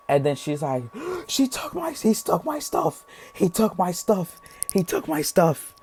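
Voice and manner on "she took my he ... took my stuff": gasping, very high wail, slowing and sinking; hammed-up sobbing complaint in a squeaky voice; mock crying. Imitating the crying woman whose stuff got taken, mock wailing. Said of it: "And then we hear crying".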